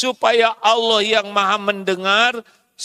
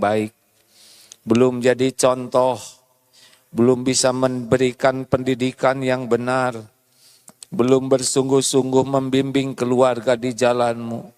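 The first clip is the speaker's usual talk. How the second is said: lower, hushed prayer of repentance; humble repentant prayer; 7 semitones lower than usual. Retold or imitated. Husband confessing his failings to God in a low humble prayer. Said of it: "Istighfar dulu".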